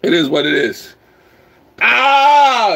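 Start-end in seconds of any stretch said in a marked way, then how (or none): 1.8-2.7 excited shout. Real, not acted. Hyped shouting on stream.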